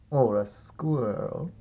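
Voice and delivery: ordinary delivery, nothing marked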